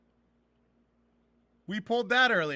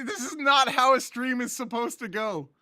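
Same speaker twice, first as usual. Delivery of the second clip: normal talking voice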